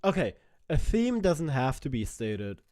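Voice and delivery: loud mocking rhetorical question; loud sarcastic rebuttal to the video; sarcastic rant. Real, not acted. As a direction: tear into the argument with loud sarcasm. Sarcastic rebuttal to clip argument.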